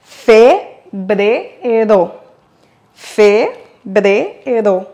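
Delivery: Febrero split slowly into Fe-bre-ro; syllable by syllable. Shown as a demonstration. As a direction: break Febrero into three slow syllables. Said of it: "en le décomposant ... à voix haute".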